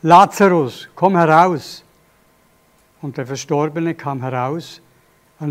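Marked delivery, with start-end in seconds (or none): 0.0-1.8 louder, ringing command; loud call. Acted. Jesus calling the dead Lazarus out, a loud command. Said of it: "rief er mit lauter Stimme".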